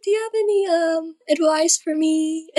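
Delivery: put-on young fan asking a superstar, nervous and high; nervous kid. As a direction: shyly ask your hero for advice. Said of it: "like, so nervous".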